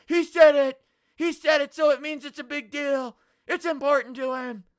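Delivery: high, loud mimicry of overreacting viewers; mock outrage. Streamer mimics viewers making a big deal, mock outrage. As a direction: parrot the accusers in a shouted, high voice.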